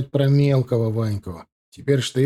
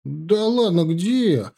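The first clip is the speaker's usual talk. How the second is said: high-pitched, dumbfounded drawl; drawn-out bewilderment; 6 semitones higher, much slower than usual. A narrator's reading. Young soldier told his boot is dirty, bewildered drawl. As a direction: stretch it out, taken aback. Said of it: "протянул огорошенно Лёнька".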